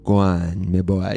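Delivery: a hint of a Creole accent. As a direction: imitate a Creole grandmother's AI voice, lightly. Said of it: "talk to me more like you're from Creole".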